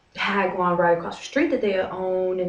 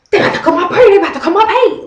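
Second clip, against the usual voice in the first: high, loud panicked yell at mom; a real-sounding outburst, high and loud; upset yelling; 12 semitones higher, 12 dB louder, much faster than usual. Retold or imitated. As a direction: shout the news to mom in outrage. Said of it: "I got so upset".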